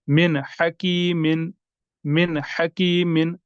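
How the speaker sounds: "मिन हकीमिन" slow, न of मिन clearly sounded; careful recitation; clear noon. Shown as a demonstration. Teacher recites with clear noon. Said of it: "नून को जाहिर करके".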